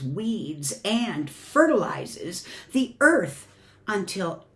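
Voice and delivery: storybook reading with swelling and hushed lines; gentle expressive story voice for the kids; reading to children. Acted. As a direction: read it like a bedtime picture book. Reads picture book to congregation.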